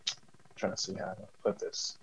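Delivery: soft, unvoiced aside to self; whispered aside. Real, not acted. Host searching for words mid-question, whispered aside. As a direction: breathe it out while searching for words.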